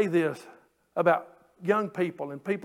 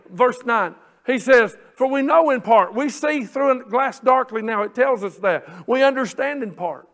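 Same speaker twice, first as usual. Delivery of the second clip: loud, ringing pulpit delivery; full-force preaching; 3 semitones higher, 7 dB louder than usual. Acted. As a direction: proclaim the verses to the congregation.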